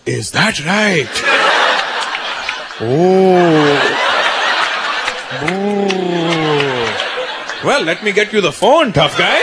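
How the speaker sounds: put-on slow, accented father calling a bluff; mocking dad. Dad calls his son's bluff, mocking and unhurried. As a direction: play dad, drawn-out oohs of pretend fear. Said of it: "had your parents call your bluff".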